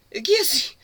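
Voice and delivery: high-pitched worried voice; worried question. Acted. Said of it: "Cable asked, full of worry".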